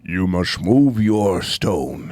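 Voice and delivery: low, slow, booming owl pronouncement; Great Owl voice. Retold or imitated. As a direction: intone it like an ancient ominous owl.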